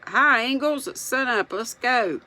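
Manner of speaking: high hailing call; shout. A narrator's reading. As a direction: call out cheerfully to a neighbour across the yard. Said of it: "they heard him shout".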